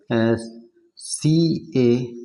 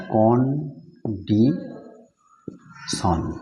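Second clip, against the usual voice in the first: Condition broken into three slow, separate syllables; much slower than usual. Shown as a demonstration. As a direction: say condition as কন, ডি, শন with long gaps. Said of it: "এতে তিনটি syllable আছে".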